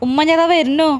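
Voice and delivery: loud, high call from behind a door; daughter-in-law answering from the bathroom, loud high call. Acted. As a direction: shout back from the bathroom. Calling back from the bathroom.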